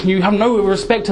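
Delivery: normal talking voice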